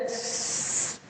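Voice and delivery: a bare, unvoiced S- held long; whistling sofir. Shown as a demonstration. Teacher isolating the sofir, long hissed s. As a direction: sustain S- as a thin hiss. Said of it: "itu sofirnya".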